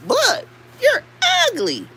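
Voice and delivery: very high squealed taunt, falling at the end; high-pitched mocking call; taunting demo. Shown as a demonstration. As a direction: squeal 'ugly' high and let it fall. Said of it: "Say it just like that".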